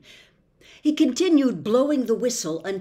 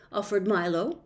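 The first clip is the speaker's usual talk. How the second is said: ordinary delivery, nothing marked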